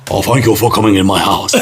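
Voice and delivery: a hint of a Japanese accent, bowing politely. [name] mimics his mum greeting the dog, light Japanese accent. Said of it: "I can do Japanese".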